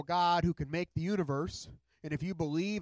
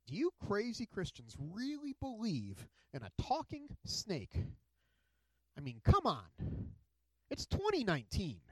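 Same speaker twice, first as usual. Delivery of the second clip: mocking atheist tone; 5 dB quieter than usual. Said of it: "if you use a certain tone of voice".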